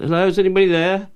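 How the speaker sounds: high, loud, fast call of hello into an empty kitchen; shouting. Retold or imitated. Actor shouting into empty hotel kitchens on Christmas Day, loud and high. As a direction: shout it out fast to whoever might hear. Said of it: "and I shout".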